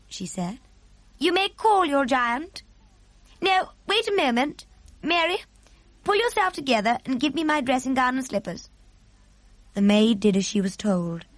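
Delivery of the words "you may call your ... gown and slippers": high regal voice, composed orders; queen taking charge, pitched up; commanding queen. A narrator's reading. Queen orders maid to bring dressing gown, high and commanding.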